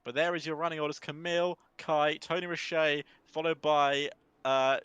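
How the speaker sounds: lively play-by-play race call; race commentary. Acted. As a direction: call the race live, building excitement.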